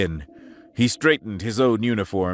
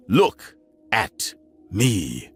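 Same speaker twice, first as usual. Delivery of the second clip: slow menacing repeat; much slower than usual. Acted. Captain gripping the alien, slow and menacing. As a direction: Look at me, slowed right down and pressed. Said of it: "a low, vicious hiss".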